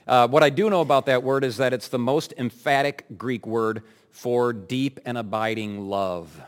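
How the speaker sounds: normal talking voice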